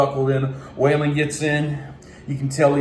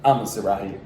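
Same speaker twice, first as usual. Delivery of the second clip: timid homebody; 9 semitones lower, faster than usual. Retold or imitated. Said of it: "the other one's like".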